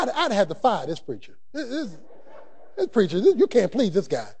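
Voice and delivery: normal talking voice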